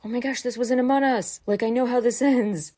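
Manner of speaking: high, quick excited quote with a laugh creeping in; Junwoo imitation. Fan redoing a favorite line from episode one, amused high-pitched imitation. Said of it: "just the way he says it".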